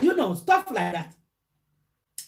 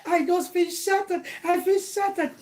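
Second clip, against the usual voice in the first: high-pitched mock sobbing lament; put-on tearful, heartbroken whine; imitated crying; 5 semitones higher than usual. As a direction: wail it like a weeping friend, hammed up. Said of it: "while she was crying".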